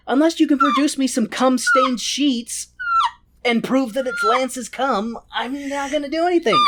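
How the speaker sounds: high, laughing, half-shouted rant; mock rant. Werther ranting to Mordred, high half-laughed shout. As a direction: rant like a fed-up king.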